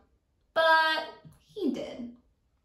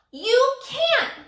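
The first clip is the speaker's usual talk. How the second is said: much higher, loud bully yell; 11 semitones higher, 7 dB louder than usual. Acted. Teacher as Sammy, yelling at Kevin. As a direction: shriek the refusal. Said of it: "he yelled".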